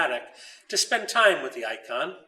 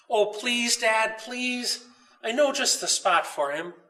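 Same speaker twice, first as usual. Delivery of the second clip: put-on pleading child; 6 semitones higher than usual. A narrator's reading. Child begging his father.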